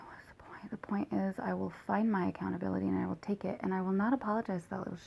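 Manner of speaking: angry whisper to herself. Real, not acted. Venting alone in a bitter whisper. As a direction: hiss it under her breath.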